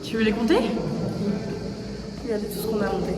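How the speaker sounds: out of breath on stairs; panting while climbing. Real, not acted. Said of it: "comme si j'étais malade". (essoufflé dans l'escalier)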